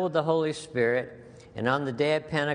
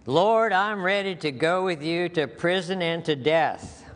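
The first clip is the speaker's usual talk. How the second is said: louder and higher, boastful Peter; a hint of boastful Peter, raised and bold; eager Peter; 6 semitones higher, 5 dB louder than usual. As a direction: play brash, overconfident Peter. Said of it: "typical Peter".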